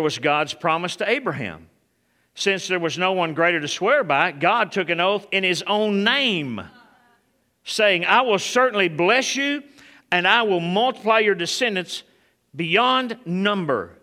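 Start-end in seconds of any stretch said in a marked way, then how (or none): 2.3-14.0 preaching. Acted. Reads God's oath with force.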